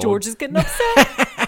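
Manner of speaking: loud, drawn-out comic repeat; slow, hammed-up indignation with a laugh; laughing mock outburst. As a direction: stretch it out loudly through laughter.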